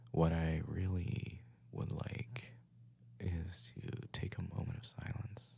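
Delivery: slow hushed whisper; soft ASMR delivery. Pretend. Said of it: "Why are we doing A S M R right now?".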